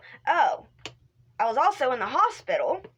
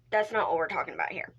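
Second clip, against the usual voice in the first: ordinary delivery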